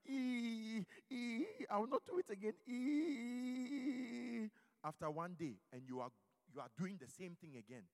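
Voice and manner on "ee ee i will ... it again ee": whimpering mock sob, higher and louder; hammed-up wailing promise; mock crying. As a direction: act out a sinner's fake crying. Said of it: "then you, you start crying".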